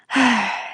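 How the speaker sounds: soft, short sigh; weary sigh. Real, not acted. Before defending my voice acting, sighing. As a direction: let out a quiet sigh.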